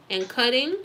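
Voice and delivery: ordinary delivery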